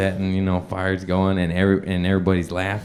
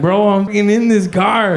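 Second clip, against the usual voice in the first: much higher, louder exclaim; alarmed; 10 semitones higher, 4 dB louder than usual. Retold or imitated. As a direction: yelp in disbelief. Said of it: "And then I'm like".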